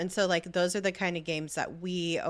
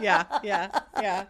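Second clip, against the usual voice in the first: laughing; 3 semitones higher, 4 dB louder, slower than usual. Real, not acted. Laughing after the rich bit.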